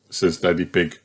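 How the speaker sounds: usual speaking voice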